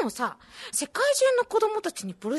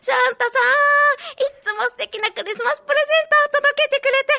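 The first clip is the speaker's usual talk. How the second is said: calling out; 11 semitones higher, 5 dB louder than usual. Acted. Child calls to the sky.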